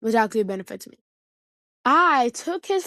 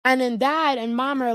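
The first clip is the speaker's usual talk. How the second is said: ordinary talk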